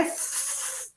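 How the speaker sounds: "f" as a long pure hiss of air, no vowel; isolated F sound. Shown as a demonstration. Pupil answers with the first sound, "f" held as bare breath. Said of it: "Que som dizemos no início?".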